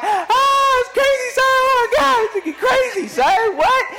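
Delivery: high-pitched, ecstatic shouting with a laugh; excited fan reaction. Retold or imitated. Dad and son reacting to a Clipper game dunk, wildly excited. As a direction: whoop like a fan stunned by a huge dunk. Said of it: "We're excited".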